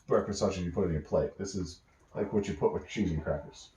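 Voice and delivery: ordinary delivery, nothing marked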